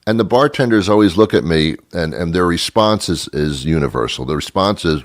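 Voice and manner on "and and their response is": ordinary talk, nothing marked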